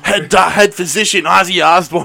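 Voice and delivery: playful metal-show holler; mock rock announcer. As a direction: announce the band to a roaring crowd.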